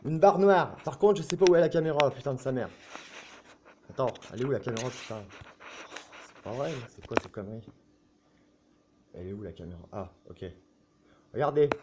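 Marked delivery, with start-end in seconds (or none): 2.1-10.4 low, quiet grumbled curses under his breath; muttering to himself. Real, not acted. Vlogger hunting for his camera, muttering quietly. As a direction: grumble it aside, not for the audience.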